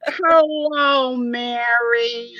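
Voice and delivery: long drawn-out hello, sung out high; imitated greeting. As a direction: draw out the greeting like an impersonation. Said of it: "But she's like".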